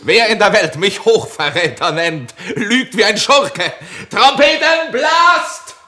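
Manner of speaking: high, loud defiant challenge; booming echoing villain monologue. Acted. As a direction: hurl the challenge at the whole camp. Edmund's defiant challenge, trumpets called.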